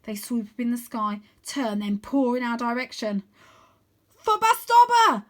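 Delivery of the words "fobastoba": high, loud, slow command shout. A narrator's reading. Arthur shouting to make Vlad dive. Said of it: "I shout".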